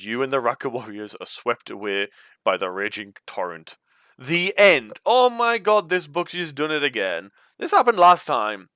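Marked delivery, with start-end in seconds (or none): 4.2-5.0 surprised. Real, not acted. Reacts in disbelief to ending. 5.0-8.7 high, loud disbelief; exasperated. Real, not acted. Exasperated at book ending again.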